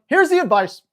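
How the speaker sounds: usual speaking voice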